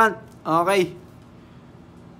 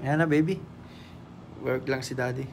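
much lower, much softer, hushed; 10 semitones lower, 13 dB quieter, faster than usual. Real, not acted. Father quietly telling his baby he is working.

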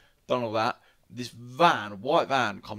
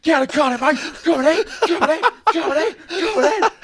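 high, loud mock yelling of a thug; aggressive goading shout; 10 dB louder, faster than usual. Retelling a rough van driver goading him to fight, loud and high. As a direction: taunt over and over, loud.

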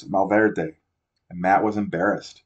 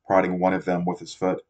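usual speaking voice

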